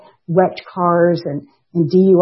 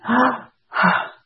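soft high gasps of sudden recognition; breathless little gasps of revelation; gasping realization; 6 semitones higher, 3 dB quieter than usual. Retold or imitated. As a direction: gasp as the words hit home. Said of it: "I just went".

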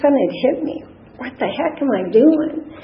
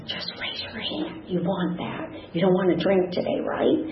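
whispered and gentle, much softer; hushed whisper; 8 dB quieter than usual. Retold or imitated. AA angel urging a crying newcomer to raise her hand, whispered. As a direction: lean in and coax her quietly. Woman coaxing her in meeting.

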